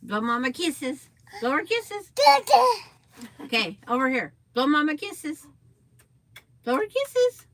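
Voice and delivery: squeaky high sing-song coaxing. Real, not acted. Coaxing toddler to blow kisses.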